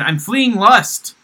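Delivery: slow, loud, earnest fleeing lust; solemn slow declaration. Retold or imitated. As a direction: proclaim it slowly and gravely. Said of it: "And he said".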